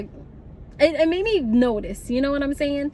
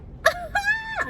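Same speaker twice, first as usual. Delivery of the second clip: panicked laugh-scream; 3 dB quieter than usual. Retold or imitated. Panicked laugh at nearly caught.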